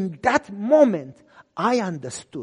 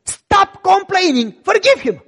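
much higher, louder scolding order; stern command; 11 semitones higher, 10 dB louder, slower than usual. Retold or imitated. Pastor scolding the unforgiving man, stern loud command. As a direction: bark it like a fed-up father.

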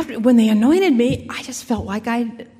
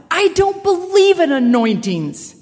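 higher, slow mock outburst; retold yell; 7 semitones higher, 3 dB louder than usual. Pastor yelling at Janet as she comes in, a mild mock yell. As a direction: blurt it out, higher and drawn out. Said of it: "blurts out, yells out".

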